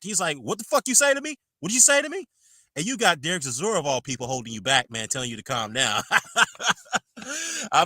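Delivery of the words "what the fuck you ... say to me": high-pitched incredulous snap; mock outrage, voice jumping way up; snapping. Retelling the boxer snapping at the referee, high angry snap. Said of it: "he snapped".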